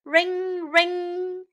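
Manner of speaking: high, drawn-out "ring, ring"; ringing sound. Acted. Storyteller imitates ringing for young learners, high drawn-out ring. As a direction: imitate a ringing bell, each word held.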